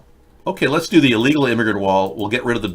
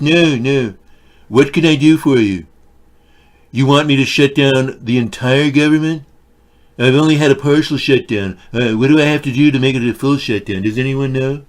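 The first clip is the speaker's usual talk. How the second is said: hammed-up Trump taking a call from Vladimir; Trump impression; 3 semitones higher, 5 dB louder than usual. Trump voice skit on phone.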